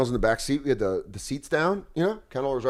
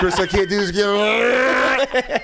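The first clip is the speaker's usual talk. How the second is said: laughing; 6 semitones higher, 4 dB louder, slower than usual. Real, not acted. Laughing exasperated plea.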